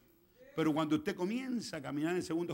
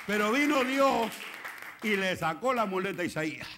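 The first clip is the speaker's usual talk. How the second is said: full-force preaching; 4 semitones higher, 7 dB louder than usual. Real, not acted. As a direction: proclaim it to the congregation with force.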